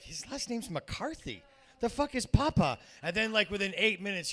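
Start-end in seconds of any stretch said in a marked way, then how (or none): 0.0-2.8 lightly voiced wife; a hint of an irritated wife. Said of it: "Christy's looking at me like".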